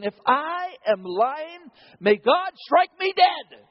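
loud, slow, high oath; invoking a curse. Acted. Peter swearing before the crowd, loud and slow. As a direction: call down the curse on yourself.

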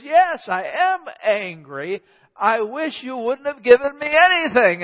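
a hammed-up angry wife insisting she means it; mock angry wife. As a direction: play the fed-up wife snapping back, not for real. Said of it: "And she says".

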